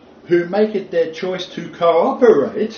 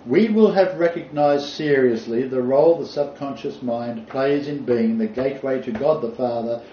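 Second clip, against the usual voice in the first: usual speaking voice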